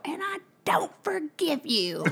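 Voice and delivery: put-on high, breath-filled little-kid voice. Six-year-old refusing his brother forgiveness, imitated upset child voice. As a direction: imitate an upset small child, half whispered. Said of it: "And then I heard Ty go".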